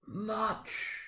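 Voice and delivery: high, soft hollow ghost voice; one breathed word from beyond the grave; ghostly Marley. A narrator's reading. Dead partner answers Scrooge, high and soft. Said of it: "Marley's voice".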